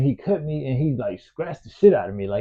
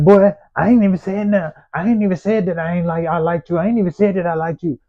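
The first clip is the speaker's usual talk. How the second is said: mocking the boy's protest; 6 semitones higher than usual. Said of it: "he like".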